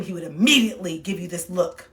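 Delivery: ordinary talk, nothing marked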